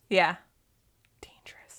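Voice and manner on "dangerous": breathy whisper. Real, not acted. Whispered aside.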